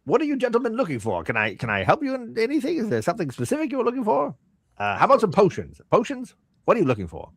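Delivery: high, swooping merchant voice; eager shopkeeper. Acted. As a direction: play a shopkeeper fussing over new customers.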